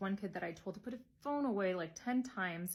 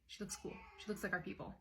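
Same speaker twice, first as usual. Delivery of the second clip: hushed gossip; 11 dB quieter than usual. Acted. Staff gossip about newcomer.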